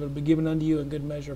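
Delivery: usual speaking voice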